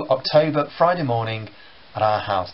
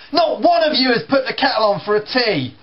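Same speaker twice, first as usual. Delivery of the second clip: higher, louder complaining father; grumbling dad; 8 semitones higher, 4 dB louder than usual. Retold or imitated. Dad scolding his son over breakfast, louder and higher. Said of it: "Pause, then looks at the kettle".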